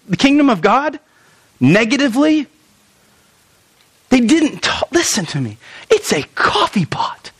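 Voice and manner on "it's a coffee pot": hushed, breathy aside; whisper. Real, not acted. Pastor whispering to the congregation about a coffee pot. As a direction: lean in and whisper it like a secret.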